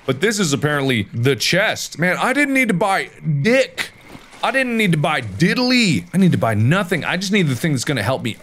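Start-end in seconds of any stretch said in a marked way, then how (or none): none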